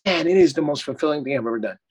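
normal talking voice

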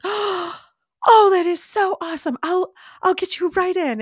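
high, loud delighted nurse; excited nurse. Retold or imitated. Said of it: "And she goes, gasps".

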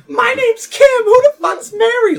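very high, loud furious shriek; angry wife. Pretend. A wife hearing another woman's name, shrieking high and loud.

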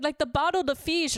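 very high, put-on Brazilian accent; imitated Brazilian accent. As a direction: imitate a Brazilian newcomer pleading in accented English. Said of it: "she's like".

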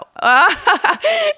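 high, bright burst of laughter at her own slip; real laugh. Real, not acted. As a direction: laugh off the fumble, amused.

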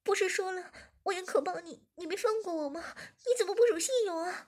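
high, loud, pleading protest; protesting woman. A narrator's reading. Zhiming accuses the boss, high and pleading. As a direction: cry out that he broke his word.

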